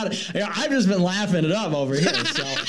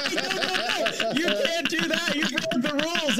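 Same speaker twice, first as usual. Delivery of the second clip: whiny streamer; 9 semitones higher, 3 dB quieter than usual. Retold or imitated.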